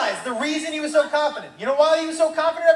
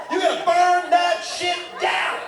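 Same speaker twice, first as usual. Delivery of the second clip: slow, drawn-out higher shout; emphatic yell; 6 semitones higher, slower than usual. Acted. Comedian telling the crowd to burn it down, emphatic yell. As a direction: yell each word out, stretched wide. Punchline yelled about burning the house.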